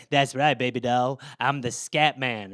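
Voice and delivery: smooth scat man. Retold or imitated. Jazz singer boasting to a confused listener, low and cool. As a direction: purr it like a slick jazz cat. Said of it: "some guy was just like".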